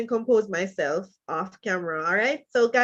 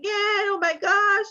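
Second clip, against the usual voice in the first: shocked gasp; 10 semitones higher, slower than usual. Real, not acted. Shocked at Zoom intruders live.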